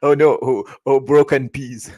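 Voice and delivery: anglais à la mauricienne, joué avec amusement, un peu plus fort; mock English-mixed Creole. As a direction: singer un Mauricien d'Angleterre, plus fort et en riant presque. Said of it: "je vais pas dire ça comme ça en anglais". (accent anglais caricaturé, plus fort)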